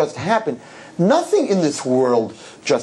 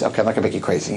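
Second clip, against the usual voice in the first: ordinary delivery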